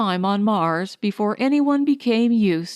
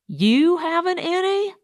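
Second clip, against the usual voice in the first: much higher, shrill alarm; 9 semitones higher than usual. A narrator's reading. Fatso learns there is no water, shrill and alarmed. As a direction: voice rising shrilly in panic. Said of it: "Fatso's voice rose shrilly".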